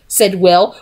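ordinary delivery, nothing marked

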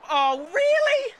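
slow, high rising disbelief; incredulous. Real, not acted. Gamer doubting what just happened, incredulous. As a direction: stretch it upward in disbelief.

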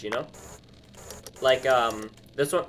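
ordinary talk

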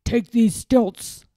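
slow, firm order from a villain; commanding. A narrator's reading. Lord Droon ordering the page boy, commanding. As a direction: give a stern command. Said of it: "commanded Lord Droon".

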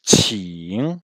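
QING drawn out, airy ts start, dipping then rising; slow model "qing"; pronunciation demo. Shown as a demonstration. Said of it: "qui se prononce".

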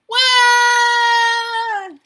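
loud, high, long wail; toddler bawling. A narrator's reading. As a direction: bawl like an upset toddler. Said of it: "Trixie bawled".

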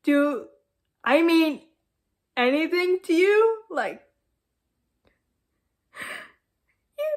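tearful melodramatic pleading; tragic heroine sorrow. Acted. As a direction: sob it out like a soap heroine. Melodramatic breakup, crying.

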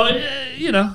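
ordinary talk, nothing marked

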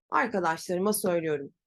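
ordinary talk, nothing marked

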